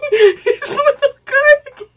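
high, squealing laugh through the words. Real, not acted. Cracking up at his cardigan.

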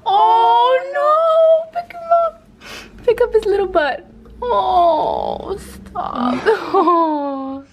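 high, loud wail of sympathy; cooing alarm. Real, not acted. Owner fretting that the kitten will fall, cooing alarm. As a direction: fret and melt over a stumbling kitten.

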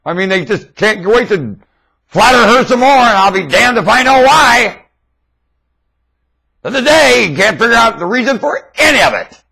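loud, high-pitched ranting; heated rant. Real, not acted. Ranting about the Queen's flatterers, loud and high. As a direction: rail against the monarchy at full volume.

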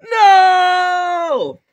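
very loud, high, drawn-out no; screamed refusal. Acted. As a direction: wail it out in protest. Child refuses being told on.

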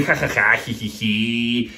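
slow silly giggle; mock giggle. Comedian mimicking people stuck at home, silly giggle. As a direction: giggle like a goofy kid.

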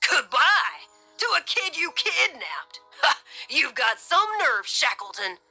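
high, scoffing disbelief with a "ha"; derisive. A narrator's reading. Officer mocking the kidnapper's request, derisive. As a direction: scoff at him incredulously. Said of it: "Stern laughed derisively".

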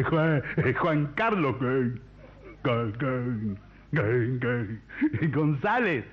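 dragged stammer, then the word bursts out; hammed-up stutter with stuck syllables; stutterer. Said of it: "Un tartamudo golpea en una casa". (tartamudeo cómico y arrastrado)

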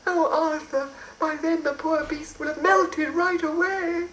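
very high worried fairy; anxious fairy wail. Acted. Fairy despairs.

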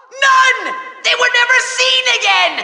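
high, triumphant villain yell; gleeful villain shout. Acted. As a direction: crow the punchline with glee. Villain punchline to riddle.

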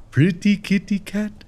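high, voiced coaxing; high cat call. Player calling the kitty cat, high voiced coo.

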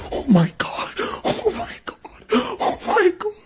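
high, breathy frightened cries; gasping in real panic; panicked. Real, not acted. Scared, panicking in dark.

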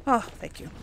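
ordinary delivery

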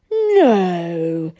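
loud, drawn-out amazed "no"; very impressed. A narrator's reading. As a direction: gasp the word in awe as a snob. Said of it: "very impressed".

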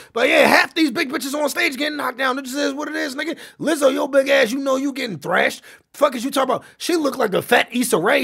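loud, high-pitched ranting; renewed heated rant. Real, not acted. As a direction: fire the roast back up, raising voice and pitch. Rant flares up again.

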